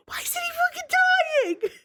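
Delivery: high, exasperated squeal of disbelief; frustrated squeal. Retold or imitated. Friend recalls gaming frustration, squealing in exasperation. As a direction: squeak it out, baffled at the game.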